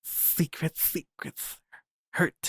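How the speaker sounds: hushed secretive whisper. Acted. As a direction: breathe it like a secret.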